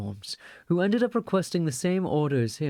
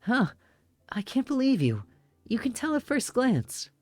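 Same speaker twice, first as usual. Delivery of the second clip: put-on higher, incredulous girl; girl's indignant voice; 3 semitones higher than usual. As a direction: scoff at them, high. Beautiful girl objects.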